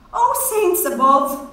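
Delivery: high breathless exclaim; high sigh. A narrator's reading. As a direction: gasp it out high. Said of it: "sighed above her jumping rose".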